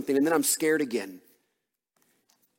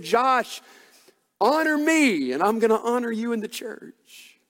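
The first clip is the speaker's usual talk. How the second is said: high, ringing preacher's voice, pressing each word; preaching at full force; 8 semitones higher, 3 dB louder than usual. Acted. Preacher to his congregation, retelling God's promise at full force. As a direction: lift it like a revival pulpit.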